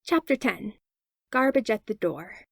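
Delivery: usual speaking voice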